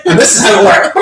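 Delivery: high, loud, laughing excitement; real laughing outburst of discovery; excited realisation. Real, not acted. Sudden realisation while laughing.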